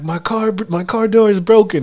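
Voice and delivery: mock dismay of a skit character; distressed skit character. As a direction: raise the voice like someone announcing trouble. Voices a skit character calling out a problem.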